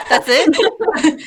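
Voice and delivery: disbelief. Real, not acted. Mock disbelief at short answer.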